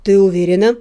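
low, stern male voice; stern question. A narrator's reading. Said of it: "строго спросил".

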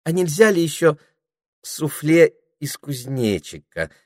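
a hint of a fawning snake angling for dessert; wheedling. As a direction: sweet-talk for a little extra. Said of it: "вкатчиво осведомилась Дульчебелла".

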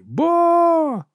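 very high, held cheer; high-pitched, drawn-out fan's cry; screamed syllable. A narrator's reading. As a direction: shriek it as one long note. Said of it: "кричала перший склад його імені".

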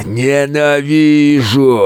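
slow, drawn-out furious beast roar; roaring monster. A narrator's reading. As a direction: roar it out in pain and hatred. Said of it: "ревел он".

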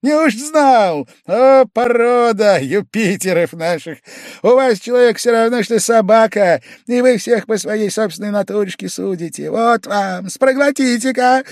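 bang the table in words; furious outburst. A narrator's reading. Pavel Pavlovich raging at Velchaninov, very high and loud.

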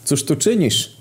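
lofty, overblown greeting; exaggerated solemnity. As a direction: declaim it with mock grandeur. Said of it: "z przesadną podniosłością".

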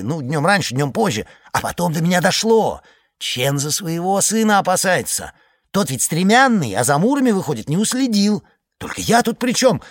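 high, lively village gossip voice; chattering villager. Acted. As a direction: talk like an excited simple iceman. Iceman character voice.

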